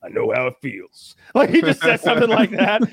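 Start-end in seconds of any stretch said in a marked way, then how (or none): 0.0-1.1 lightly voiced action-hero impression; a hint of a movie tough guy commiserating; Rambo. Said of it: "if Rambo was actually there".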